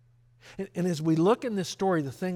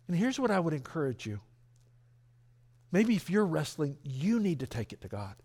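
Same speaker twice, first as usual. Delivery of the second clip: ordinary talk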